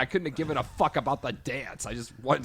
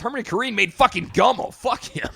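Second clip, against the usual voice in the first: loud exasperated rant; angry outburst; 9 semitones higher, 8 dB louder than usual. Real, not acted. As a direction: spit it out in disgust. Ranting about the director.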